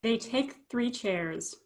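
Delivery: ordinary delivery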